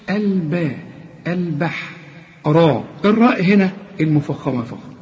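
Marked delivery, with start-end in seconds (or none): none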